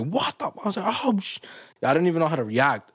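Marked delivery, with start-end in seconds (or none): 0.0-1.4 shocked. Retold or imitated.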